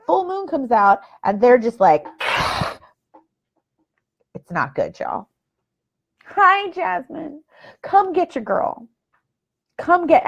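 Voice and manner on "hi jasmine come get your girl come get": high, slow mock plea. Real, not acted. Calling a friend to fetch daughter.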